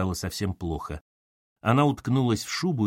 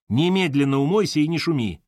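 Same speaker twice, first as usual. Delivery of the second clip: raised, louder reprimand; stern order; 6 semitones higher, 5 dB louder than usual. A narrator's reading. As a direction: order firmly, louder and higher. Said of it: "вдруг оборвала её Марья Дмитриевна".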